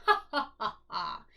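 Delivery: loud belly laugh, knee-slapping; Fred laughs loud at the hat; hearty chuckle. Acted. Fred laughing loudly at Rosie's cheese hat. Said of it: "he chuckled out loud".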